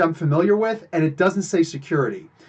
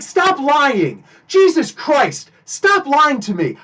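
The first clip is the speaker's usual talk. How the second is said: raised, exasperated, slow on Jesus Christ; exasperated retold outburst; 7 semitones higher, much slower than usual. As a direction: snap at him to stop lying. Calling out the guard's lie.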